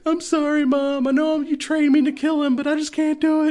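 very high-pitched tearful whine, hammed up; mock hysterical crying. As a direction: imitate a daughter breaking down in a squeaky sob. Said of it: "crying hysterically".

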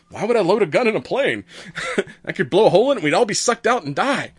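a touch of exasperated scientist; mock indignant. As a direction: argue it like it's obvious. Scientist defends unloaded gun.

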